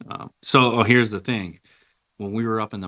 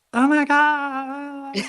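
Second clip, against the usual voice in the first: put-on high, drawn-out girly squeal; excited squeal; 6 dB louder, much slower than usual. Girlfriends excited, imitated squeal.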